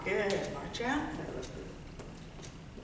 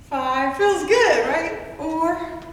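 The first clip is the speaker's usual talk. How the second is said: excited; 7 semitones higher, 8 dB louder, much slower than usual. Real, not acted. Fitness instructor pushing class.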